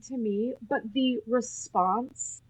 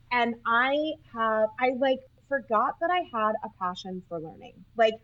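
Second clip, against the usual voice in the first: ordinary delivery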